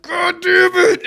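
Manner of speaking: high, loud, drawn-out curse; mock howl through a blast of water; exasperated shout. Water blasting in his face, exasperated shout.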